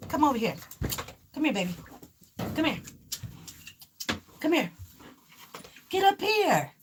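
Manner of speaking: high-pitched command to a dog; real coaxing dog call. Real, not acted. Calling her dog to jump up.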